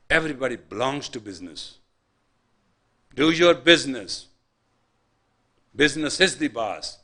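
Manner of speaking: sermon in full cry; fiery preaching. Acted. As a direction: preach it at full force to the crowd. Preaching at rising force.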